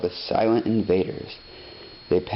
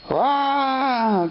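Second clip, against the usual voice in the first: put-on baby wail. A narrator's reading. Narrator voicing the baby's scream at the music, high wail. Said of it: "cried the baby".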